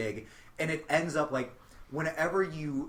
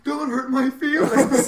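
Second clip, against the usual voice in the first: whiny sensitive guy; 10 semitones higher, 10 dB louder, slower than usual. Retold or imitated. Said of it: "he's like".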